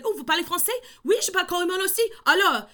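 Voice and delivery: high, loud, lively French; retold eager back-and-forth in French; excited French exchange. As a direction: switch into French with delighted energy.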